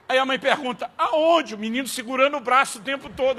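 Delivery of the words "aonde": tender mom question. Retold or imitated. Mother's question.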